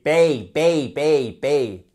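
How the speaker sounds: BAY repeated as a clear diphthong; drilled syllable. Shown as a demonstration. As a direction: drill BAY over and over, firmly. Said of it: "Et pas baby. C'est bay".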